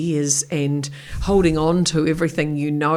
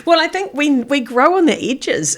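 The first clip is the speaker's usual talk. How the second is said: laughing; 10 semitones higher, 5 dB louder than usual. Real, not acted. Guest laughs while answering.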